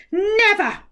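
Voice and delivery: high, sulky pout. Acted. Giant mouth pouts and refuses. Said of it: "pouted the mouth".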